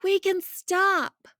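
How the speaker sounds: slow, drawn-out high call; yelled from afar. A narrator's reading. Miles yelling back from farther up, loud. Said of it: "Miles yelled from farther up".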